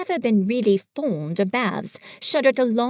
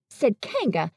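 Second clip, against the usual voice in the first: usual speaking voice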